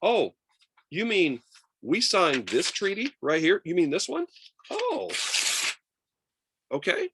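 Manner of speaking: high, loud, feigned naive surprise; mock innocence. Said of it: "you can basically just go like this".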